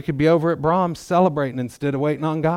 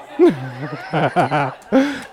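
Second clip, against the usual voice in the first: loud open laugh at the room; a happy laugh that breaks out; laughing with the congregation; real burst of laughter; 3 semitones higher, 4 dB louder than usual. Real, not acted.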